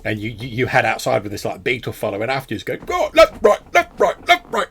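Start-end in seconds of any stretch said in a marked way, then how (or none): 2.8-4.7 high, loud marching chant; parade-ground shouting. Acted. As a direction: call the step like a drill sergeant. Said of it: "shouting in, like, this almost, like, parade ground fashion".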